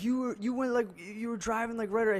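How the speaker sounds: put-on high-pitched complaining old man; high mock voice of an aggrieved driver; imitated old guy. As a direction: mimic an older man griping, pitch way up. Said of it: "he's this old guy".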